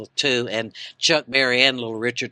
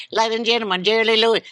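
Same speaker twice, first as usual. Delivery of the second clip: high, loud Southern announcer call; 9 semitones higher, 4 dB louder, much faster than usual. Retold or imitated. Club owner announcing [name] in Southern accent. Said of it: "would talk in a real Southern accent".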